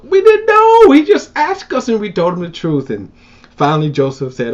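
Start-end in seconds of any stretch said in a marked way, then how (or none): 0.1-2.9 high, loud, protesting innocence; protesting sons. Retold or imitated. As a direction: cry out that they meant no harm. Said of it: "And he go".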